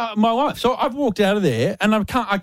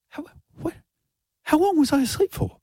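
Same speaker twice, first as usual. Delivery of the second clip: high, halting disbelief; retold groggy confusion; acted bewilderment; 10 semitones higher than usual. Retelling waking confused at the desk.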